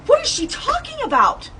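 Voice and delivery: high-pitched, stunned mock disbelief; incredulous. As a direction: retell the moment it made no sense. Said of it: "I'm like".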